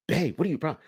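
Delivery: usual speaking voice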